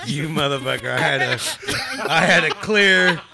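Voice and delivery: loud, laughing fake anger; mock outrage. To a friend who interrupted his thought, laughing mock outrage. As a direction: play furious at being cut off, laughing.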